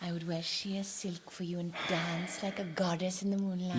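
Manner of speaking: breathy low whisper, coaxing; a wife whispering promises to win her husband over; seductive whisper. Acted. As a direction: whisper it to tempt him.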